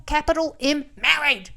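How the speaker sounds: indignant, emphatic burst on "married"; stress on married. Real, not acted. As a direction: spell out the point, then hit "married" hard. Indignant emphasis on his being married.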